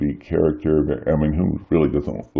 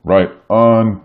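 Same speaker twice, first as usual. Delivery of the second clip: ordinary delivery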